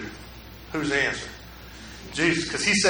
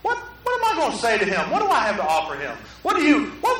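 usual speaking voice

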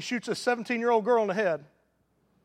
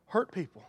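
softer "hurt people." falling away; softer echo; 2 semitones lower, 6 dB quieter than usual. Real, not acted. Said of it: "you ought to just write down".

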